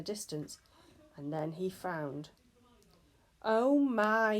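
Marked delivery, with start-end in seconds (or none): 3.4-4.4 a loud groaning "oh my"; dismayed groan at bad news. A narrator's reading. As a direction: moan it out, higher and louder. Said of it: "he groaned".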